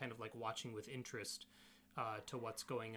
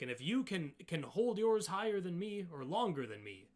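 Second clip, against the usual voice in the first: lightly voiced Goliath, raised and louder; hint of a competitive Goliath; challenging Goliath; 6 semitones higher, 7 dB louder than usual. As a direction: lay out the contest terms with force. Goliath explains the strength contest.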